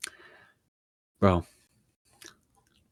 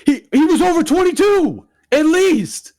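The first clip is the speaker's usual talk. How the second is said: high, loud burst of disbelief; practically shrieking the age out; indignant exclaim; 5 dB louder than usual. Real, not acted. Friends asking about ages, he blurts it out high and loud. Exclaiming how old he was.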